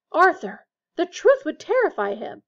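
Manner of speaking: high, loud alarmed objection; shrill. A narrator's reading. Missus Weasley objects to her husband, shrill. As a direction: cut in shrilly with protest. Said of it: "said Missus Weasley shrilly".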